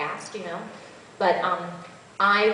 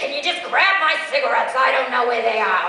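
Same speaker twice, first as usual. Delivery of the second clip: loud, higher, impatient woman; loud demanding character; brusque woman character; 7 semitones higher, 10 dB louder than usual. Shown as a demonstration. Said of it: "different characters".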